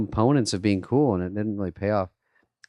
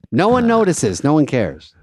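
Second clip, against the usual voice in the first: higher, louder flat dismissal; manager shutting him down; 5 semitones higher, 6 dB louder than usual. Retold or imitated. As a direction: brush him off bluntly. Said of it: "And he's like".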